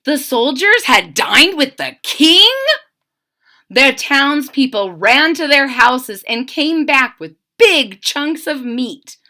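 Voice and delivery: ordinary delivery, nothing marked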